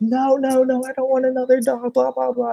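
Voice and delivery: very high-pitched imitation of a tearful protest; mock freaking out. Imitating mom seeing the new dog, high mock freak-out. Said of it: "she starts crying, freaking out".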